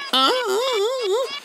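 very high, slow wailing "ah"; high mock cry. A mock high wail in a retold reply. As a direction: stretch each "ah" high and slow like a mock sob. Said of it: "me miró y me dijo".

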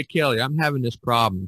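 normal talking voice

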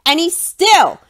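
ordinary delivery

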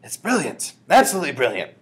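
delighted loud exclamation; high, thrilled praise; shouted. A narrator's reading. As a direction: shout it with a grin. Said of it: "a great grin ... and she shouted".

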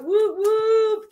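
high, loud whooping cheer. Real, not acted. Hype cheer for a colleague.

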